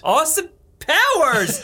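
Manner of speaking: high, drawn-out mock announcement of the name; exaggerated exclamation. Echoes name in exaggerated show-off voice.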